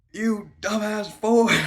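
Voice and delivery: high, loud, drawn-out insult; exasperated yell. Retold or imitated. Scolding in the retold argument, mock-angry yell. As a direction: yell it slowly in hammed-up outrage.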